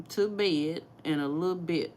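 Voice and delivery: ordinary talk, nothing marked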